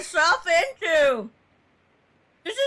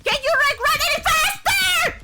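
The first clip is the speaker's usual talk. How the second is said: high, frantic yells at the screen; real panicked shouting; 10 semitones higher, slower than usual. Real, not acted. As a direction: scream at her to hurry, pitched way up. Yelling at slow game character.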